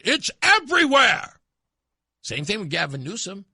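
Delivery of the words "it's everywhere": mock outcry. Mocking Harris's claim.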